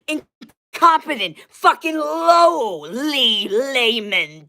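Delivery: high, drawn-out screaming insults; furious yelling. Real, not acted. Cursing out viewers in a high, slow furious yell. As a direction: spit contempt down at them.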